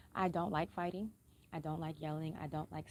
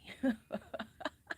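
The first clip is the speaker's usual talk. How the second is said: short breathy laugh; 4 semitones higher than usual. Real, not acted. Speaker amused by out-shouting everybody, laughing. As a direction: laugh briefly at your own boast. Laughs at own self-talk.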